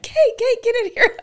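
high, loud panicked call from another room; frantic call. Retold or imitated. Husband calling his wife to the bath, panicked. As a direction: summon your wife urgently, panicking. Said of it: "Jeremy starts losing his mind".

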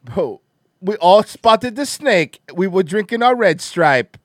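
loud put-on Jamaican accent; mock Jamaican accent. Mimicking the snake hunter, mock Jamaican accent. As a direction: lay on a Jamaican lilt, loudly. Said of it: "Oh, Jamaican Rob Gavigan?".

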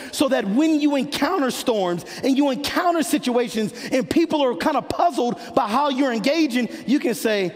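high-pitched, driving sermon delivery; fired-up preacher pressing each point home; impassioned preaching. Real, not acted. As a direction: preach it with rising urgency to the congregation. Preaches at full force.

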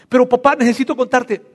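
high, loud, urgent begging; pleading son. Acted. Son insisting to his father, high and urgently pleading. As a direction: beg to be heard out.

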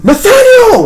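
high, loud cry of a name; anguished shout. Acted. Father cries out to his son.

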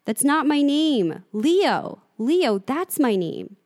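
high, loud and slow protest; a boy defiantly correcting her; insistent. Acted. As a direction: insist on your real name. Boy insists on his name.

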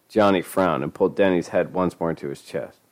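ordinary delivery

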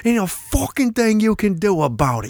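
high, hammed-up British tirade; fake British accent. Cousin bragging about taking the garage, in fake British accent. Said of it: "an American doing a British accent".

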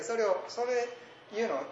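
normal talking voice

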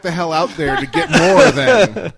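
loud laughing mock threat. Real, not acted. Joking threat over the almonds.

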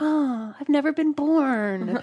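put-on high, slow childlike voice; childish voice. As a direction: sound like a naive kid. Imitates own childish logic.

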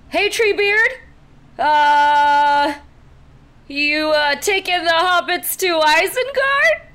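loud, high-pitched call; yelling out. Acted. [name] calls out to the tree she spots, yelling. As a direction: holler it across a distance, haltingly. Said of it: "[name] yells out".

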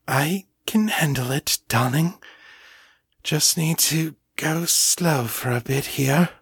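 put-on upper-class voice under strain; strained, breathless. A narrator's reading. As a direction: push the words out between breaths. Strained reply in the imitated voice.

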